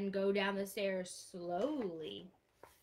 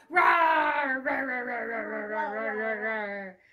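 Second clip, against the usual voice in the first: dinosaur roar; 8 dB louder, slower than usual. Pretend. Teacher roaring like a dinosaur for the class, loud. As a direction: roar it out big for the kids. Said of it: "ten little dinosaurs go".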